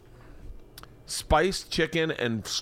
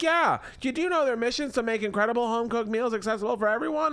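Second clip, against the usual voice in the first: much higher, sing-song wife voice; wife Leanne voice; 11 semitones higher than usual. Acted. Husband voices wife Leanne pitching Blue Apron, high sing-song. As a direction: play the chirpy wife selling the meal kit. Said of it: "Do you like this character thing I'm doing?".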